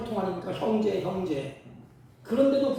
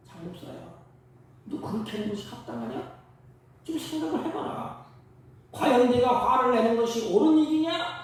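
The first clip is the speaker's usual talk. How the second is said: rising from a hushed call to a loud, high rebuke; God calling the pastor's name, then pressing him hard; voice of God rebuking; 6 semitones higher, 5 dB louder than usual. Acted. God questioning the preacher. (속삭이듯 부르다가 높고 크게 꾸짖는 하나님)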